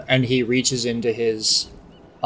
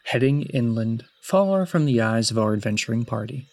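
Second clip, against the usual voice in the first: measured, solemn tale-telling; story narration. A narrator's reading. Game master's formal scene opening.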